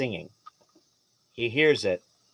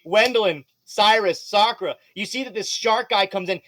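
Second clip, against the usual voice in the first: normal talking voice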